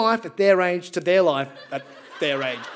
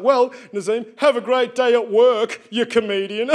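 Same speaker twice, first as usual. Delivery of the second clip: louder, slow patronising voice; condescending banker; 3 semitones higher, 4 dB louder than usual. Retold or imitated. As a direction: talk down to him sweetly.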